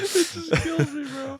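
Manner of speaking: laughing hard, high pitched. Real, not acted. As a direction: crack up mid-sentence. Laughing at friend's anecdote.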